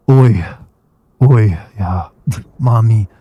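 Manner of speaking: loud, hammed-up mock Spanish accent. Streamer imitating a reviewer's Spanish accent, loud mock Spanish accent. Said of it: "they usually have a Spanish accent".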